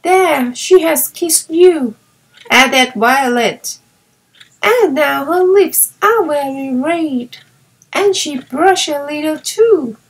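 eager young girl playing in the snow; child delighted with her new playmate; little girl Violet. A narrator's reading. Violet speaks in story.

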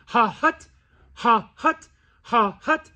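two puffs of air, ha hut; double goose cluck. Shown as a demonstration. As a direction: puff it with a T. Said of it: "two puffs of air".

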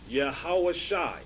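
Yahushai said slowly as yah-HA-wah-shy; "Yahushai" slowly pronounced for learners, with an "eye" ending; pronunciation of Yahushai. Shown as a demonstration. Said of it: "which is pronounced".

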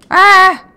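sudden loud high yelp of fright; short shocked scream; startled scream. Real, not acted. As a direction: yelp as if something jumped you. Startled by sudden game death.

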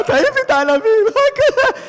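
a man in comic panic spitting out his grapes; hammed-up hysterical squeal; panicked shriek. Millionaire panics at bad news.

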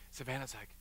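ordinary delivery

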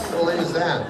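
ordinary delivery

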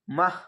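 "মাহ" closed with an audible h; pausal h ending. Shown as a demonstration. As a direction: stop on a breathed h in "মাহ". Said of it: "এরকম উচ্চারণ হয়ে যাবে". ("মাহ" হা দিয়ে থামা)

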